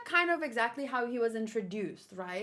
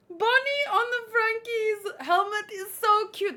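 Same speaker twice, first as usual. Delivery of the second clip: gushing; 7 semitones higher, 6 dB louder than usual. Real, not acted. Gushing over cute panel.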